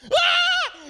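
high, loud shrill scream; child's panicked scream. Retold or imitated. Kid clinging to the platform in the lake, screaming in panic. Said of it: "He's just screaming".

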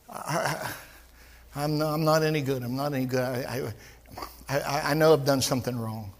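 low, soft, defeated mumble; mopey self-condemner. Retold or imitated. Preacher mimicking a gloomy believer, low and soft. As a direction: mope like someone who thinks they're no good.

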